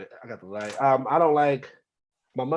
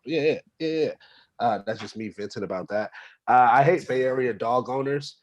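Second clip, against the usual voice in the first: ordinary delivery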